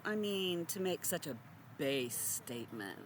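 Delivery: usual speaking voice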